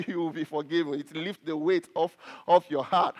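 low chuckle through the words; laughing lightly, voice dropped low; amused laughing. Real, not acted. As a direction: say it while chuckling at the absurdity. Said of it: "And I laugh".

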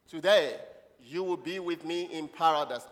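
loud, slow declamation; scripture proclamation. Acted. Preacher voices Jesus' promise to the church, proclaimed. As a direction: call out the first word, pause, then stretch the promise. Leads congregation in repeating scripture.

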